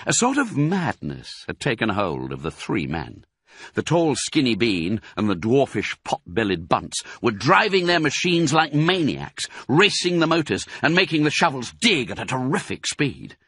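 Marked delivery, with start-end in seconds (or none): none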